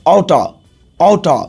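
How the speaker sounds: short casual oughta; contracted oughta. Shown as a demonstration. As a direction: say ought to as oughta, slurred into one word. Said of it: "short में बोलते हैं".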